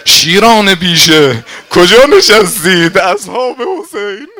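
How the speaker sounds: high, loud rallying shout. Acted. Habib summoning Hussein's companions, rallying shout. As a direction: call the companions to arms at full voice. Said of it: "فریاد کشید".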